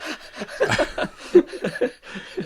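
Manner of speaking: high breathy burst of laughter; real helpless laugh. Real, not acted. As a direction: crack up at the joke. Laughing at the beer joke.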